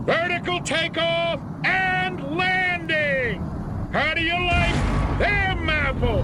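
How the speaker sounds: triumphant showman's boast; proud showing off. Acted. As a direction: crow about the plane to the rival. General shows off the plane.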